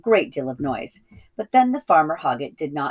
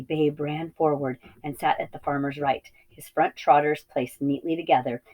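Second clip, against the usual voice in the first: plain reading voice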